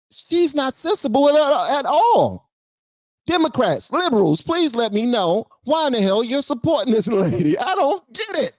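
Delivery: high-pitched exasperated plea; baffled, pleading with the audience in a high voice. Real, not acted. Exasperated rant at supporters.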